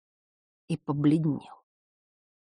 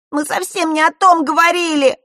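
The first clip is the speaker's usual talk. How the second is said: sobbing protest; 12 semitones higher, 8 dB louder than usual. A narrator's reading. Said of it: "плача навзрыд".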